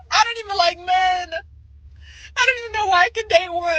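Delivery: laughing through tears about breaking up; half sobbing, half laughing; crying laugh. Real, not acted. Girl laughing through tears over her breakup, crying laugh.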